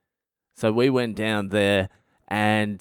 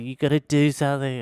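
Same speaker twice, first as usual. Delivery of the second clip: put-on higher, insistent girlfriend voice; hysterical girlfriend; 6 semitones higher than usual. Hysterical girlfriend urging boyfriend to act: mock panic. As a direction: push him, a mock panicked plea. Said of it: "she's still hysterical and she's like".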